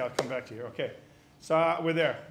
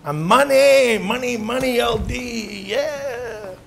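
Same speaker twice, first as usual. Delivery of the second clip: cocky self-hype; 5 semitones higher, much slower than usual. Acted.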